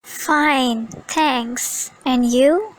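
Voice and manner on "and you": you lifted on a rising tune; curious rising and you; rising question. Shown as a demonstration. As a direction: end on a rise on you, showing interest. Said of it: "Notice the rising intonation on".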